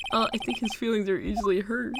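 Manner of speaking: ordinary talk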